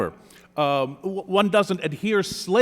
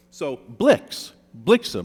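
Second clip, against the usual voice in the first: "blix" alone, then "blixen" a bit louder, with the weight on BLIX; stressed syllable demo; 5 dB louder, slower than usual. Shown as a demonstration. Poet explaining the rhyme to the jury, stress on BLIX. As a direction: split off "blix" before saying "blixen". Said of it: "against the final stressed syllable".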